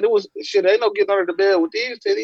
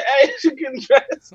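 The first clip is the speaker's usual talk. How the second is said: laughing plea; 7 semitones higher than usual. Retold or imitated. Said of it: "holding Grams off like".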